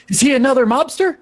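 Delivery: high-pitched, urgent character question; excitable busybody. Acted. Robert running up to Edgar, high and excitable. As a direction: pipe up in a high voice.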